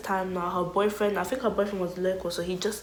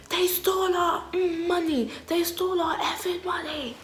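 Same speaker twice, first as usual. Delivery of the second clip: put-on much higher outraged girl voice; 9 semitones higher than usual. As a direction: shriek like she's been robbed. Girl discovers theft, panicking.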